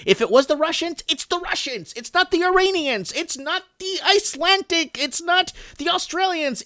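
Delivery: high, emphatic voice climbing through a list; heated insistence. Real, not acted. Speaker defends himself against seeming anti-Chinese, heated insistence. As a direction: insist it loudly at a high pitch, item by item.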